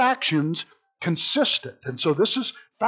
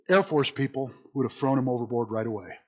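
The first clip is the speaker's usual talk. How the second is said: ordinary delivery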